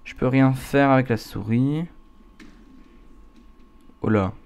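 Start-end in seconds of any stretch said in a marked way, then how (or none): none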